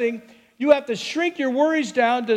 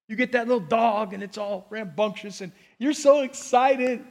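ordinary delivery

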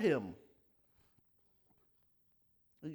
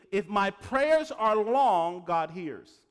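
loud slow mock belief; 7 dB louder than usual. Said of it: "And they think".

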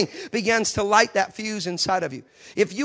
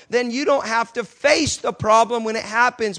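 usual speaking voice

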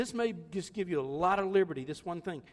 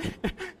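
high laugh; 11 semitones higher than usual. Real, not acted. Laughing at his own story.

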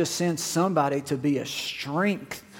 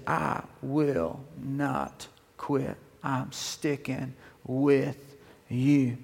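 quiet resolve; 3 semitones lower, 4 dB quieter, much slower than usual. Acted. Elisha's vow, slow, low.

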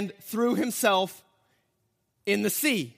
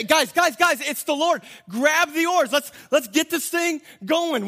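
urgent excitement; 5 semitones higher, 4 dB louder than usual. Pretend. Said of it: "He didn't say".

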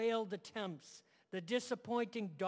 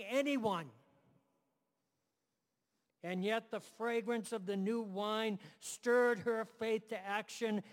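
rising sermon; 3 semitones higher, 4 dB louder than usual. Acted. Preaching builds in intensity.

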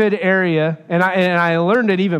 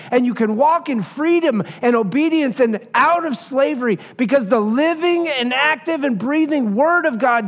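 lightly raised preaching voice, building conviction; fervent preaching; 5 semitones higher than usual. Acted. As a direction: preach it to the congregation with growing fire. Preaches the gospel to congregation.

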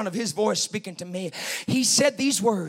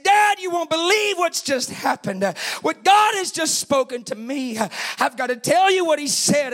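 urgent, raised-pitch phone call; acted excitement, pitched up; excited retelling; 6 semitones higher, 3 dB louder than usual. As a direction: blurt the big news to dad. Retold breathless news to dad.

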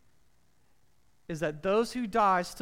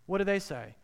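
ordinary talk, nothing marked

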